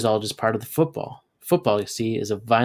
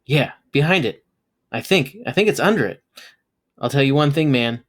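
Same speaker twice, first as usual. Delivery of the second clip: nervous; 4 semitones higher, 4 dB louder than usual. Acted. Scared of creature.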